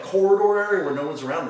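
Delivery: ordinary delivery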